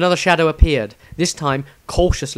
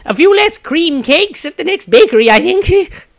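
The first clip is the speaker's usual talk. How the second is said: high, loud fussy wizard voice; a plump wizard bargaining with himself; high, loud and fussy, stress on few; Maestro's voice; 9 dB louder than usual. A narrator's reading.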